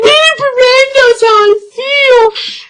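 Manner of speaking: high, loud, slow whiny wail; mock heartbroken whine. Mocking her past heartbroken self to viewers, high slow whine. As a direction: ham up a heartbroken teen moping over a band. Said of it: "I'm just like".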